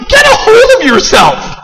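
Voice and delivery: high, loud, strained "get a hold"; wife's scolding. Retold or imitated. Wife scolds him.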